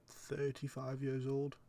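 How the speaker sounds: normal talking voice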